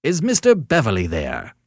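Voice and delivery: loud, high querulous caller. A narrator's reading. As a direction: demand it fretfully down the telephone. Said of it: "said a querulous voice".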